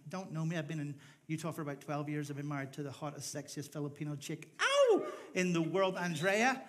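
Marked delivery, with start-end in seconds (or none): 4.6-5.0 loud, drawn-out "ow"; playful whoop. Real, not acted. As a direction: whoop it out in admiration. Playful whoop praising his wife.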